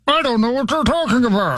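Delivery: masked villain voice; Bane impression. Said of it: "Your Bane impression".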